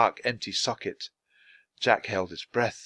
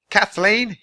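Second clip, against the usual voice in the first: high, louder call of a name; 9 semitones higher, 5 dB louder than usual. A narrator's reading. Teddy calling Kathleen out. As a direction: call across the room. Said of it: "he called".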